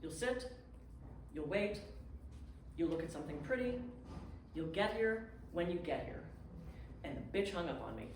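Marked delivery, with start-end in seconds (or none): 0.0-6.2 calm, soothing. Retold or imitated. Landlady calmly telling the stranded guest to wait. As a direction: settle her down gently, word by word.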